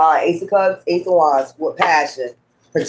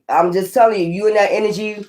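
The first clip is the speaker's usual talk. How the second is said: ordinary talk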